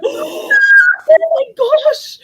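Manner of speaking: gasp, then loud high exclamation; shocked. Retold or imitated. Mom hearing her four-year-old swear, mock shocked. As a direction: gasp and cry out, hammed-up shock.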